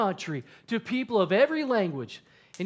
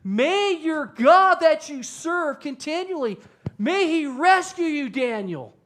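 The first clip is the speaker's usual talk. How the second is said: loud, high, drawn-out cry; yelling out; 7 semitones higher, 6 dB louder, slower than usual. Acted. King yells down into the pit to Daniel, yelling out. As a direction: call out slowly and urgently at full voice. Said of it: "he's yelling out to Daniel".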